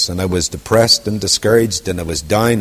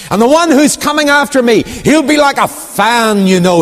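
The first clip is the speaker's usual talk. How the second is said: fiery prophet; 11 semitones higher, 4 dB louder than usual. Acted. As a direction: thunder it out as a wilderness preacher. John the Baptist proclaiming.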